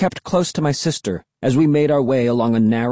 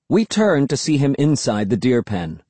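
plain reading voice

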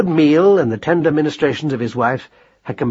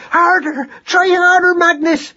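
very high frantic screech; shrieking, furious mother; furious scream; 4 dB louder, slower than usual. A narrator's reading. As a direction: scream at your son to strain. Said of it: "Madeline screamed furiously at her son".

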